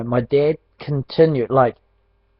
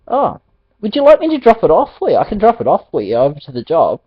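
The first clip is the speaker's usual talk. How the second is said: light, eager dad voice, higher and quicker; imitating dad; 6 semitones higher, 4 dB louder, faster than usual. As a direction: rattle off dad's helpful offer brightly. Retelling dad's eager offer.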